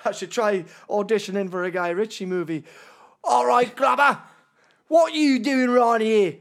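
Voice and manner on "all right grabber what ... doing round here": loud, slow, badly faked Cockney; Cockney accent. Auditioning for a Guy Ritchie movie, loud fake Cockney. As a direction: bellow it in mock Cockney. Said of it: "I'm supposed to be Cockney".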